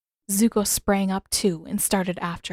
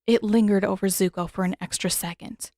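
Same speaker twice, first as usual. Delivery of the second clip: ordinary delivery, nothing marked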